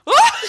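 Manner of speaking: high, loud shriek; startled scream. Retold or imitated. Friends reenacting screaming when they turned, loud shriek. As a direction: scream out together in shock. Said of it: "we were like".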